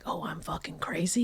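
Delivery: incredulous whisper. Retold or imitated. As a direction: whisper it back in disbelief. Retold fight, quoting herself.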